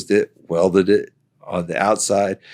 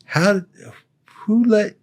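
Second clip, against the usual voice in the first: high, broken-off astonished questions; can't even finish the question; sputtering disbelief; 13 semitones higher than usual. Retold or imitated. Sergeant major baffled by walking.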